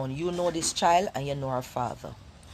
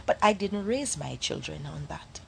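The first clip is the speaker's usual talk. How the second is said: ordinary talk, nothing marked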